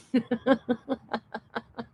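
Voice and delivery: long soft fit of giggles; tickled laughter. Real, not acted. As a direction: laugh helplessly, tickled to death. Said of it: "That tickles me to death".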